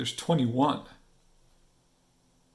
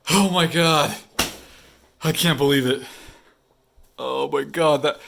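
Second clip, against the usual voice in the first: real excitement; 3 semitones higher than usual. Real, not acted. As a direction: celebrate finishing the challenge. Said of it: "see me freaking out".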